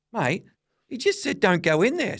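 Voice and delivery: high, loud mimicked protest; imitating alarmed Dad. Dad protesting from the car, high imitated alarm. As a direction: pitch it right up, protesting as Dad.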